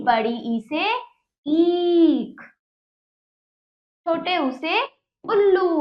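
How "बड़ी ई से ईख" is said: ईख with the ई stretched long; long drawn ee. Shown as a demonstration. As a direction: pull out the ई in ईख. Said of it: "इसको हम थोड़ा खींचेंगे". (बड़ी ई को खींचकर ईख)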